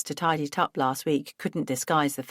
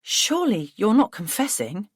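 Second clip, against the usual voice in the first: shocked; 5 semitones higher, 5 dB louder than usual. Acted. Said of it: "I gasped".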